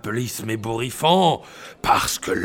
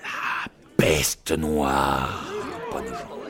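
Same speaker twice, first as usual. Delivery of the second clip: ominous narration; 11 semitones lower, 4 dB quieter than usual. Acted. Doom-laden dramatic narration.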